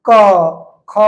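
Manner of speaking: long, held क and ख pushed out from the throat; felt in throat. Shown as a demonstration. Teacher letting students feel the throat, drawn-out क and ख. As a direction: stretch क, then ख, so the throat is felt. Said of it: "feel करियो, जेना लगते कंठ से बाहर निकल रहल छै".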